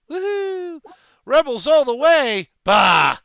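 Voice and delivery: loud, high whooping cheer; excited cheer. Real, not acted. Game master cheering for the rebel side, loud whoop. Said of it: "cheering for the rebels".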